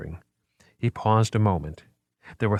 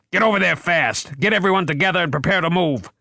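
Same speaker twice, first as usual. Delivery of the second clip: high urgent commands, fast at the end; shouted orders; 9 semitones higher, 3 dB louder than usual. A narrator's reading. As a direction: bark it over the chaos. Cop shouting orders in danger.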